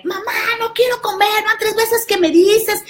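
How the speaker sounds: put-on high, whiny kid complaining to mom; bratty daughter. Daughter whines at her mother, high bratty voice. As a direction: play a spoiled girl who won't come eat.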